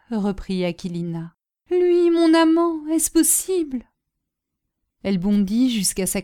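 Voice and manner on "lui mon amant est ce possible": high, slow lament, loud then easing off; anguished disbelief. A narrator's reading. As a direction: cry out slowly for your doomed lover. Woman horrified at lover's death. (plainte incrédule, aiguë et lente)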